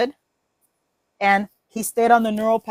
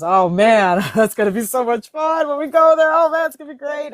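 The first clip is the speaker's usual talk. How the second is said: excited, higher-pitched gushing; giving praise; 4 semitones higher, 4 dB louder than usual. Acted. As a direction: gush with praise and anticipation. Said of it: "or you, or you give praise".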